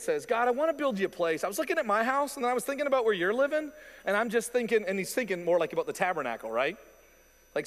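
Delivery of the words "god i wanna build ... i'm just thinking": higher, eager voice; raised, enthusiastic king offering God a gift; eager David. Retold or imitated. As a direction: pitch it up like a keen king pitching an idea.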